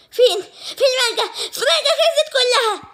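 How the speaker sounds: high, laughing shout; shocked cry. Acted. Girl finding the mango finished, high laughing shout.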